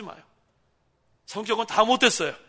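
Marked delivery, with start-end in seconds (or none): 1.3-2.4 emphatic preaching. Acted.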